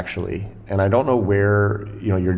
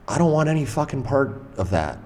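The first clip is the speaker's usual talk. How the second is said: retold irritated brush-off, higher pitch; angry refusal; 6 semitones higher than usual. Resident refusing a stranger's plea for help, irritated. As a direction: send them away in a raised pitch, not shouting.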